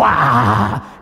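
loud commanding cry; forceful cry. Shown as a demonstration. Pastor demonstrating the command, loud cry. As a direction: push the sound out hard. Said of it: "turn go into a seven-syllable word".

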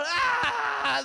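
loud anguished wail of a broken warrior; sudden loud cry of grief. Retold or imitated. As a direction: cry out like a man sobbing on his face. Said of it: "They go".

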